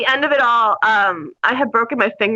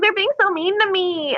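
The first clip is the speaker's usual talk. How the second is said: mock whine, higher in pitch; whining; 6 semitones higher than usual. Recruit complains about basic training, imitated whining. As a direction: imitate a self-pitying whiner. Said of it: "She was, like, whining though".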